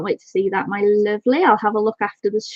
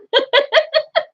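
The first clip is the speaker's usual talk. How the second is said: high-pitched delighted giggle. Real, not acted. After thanking husband.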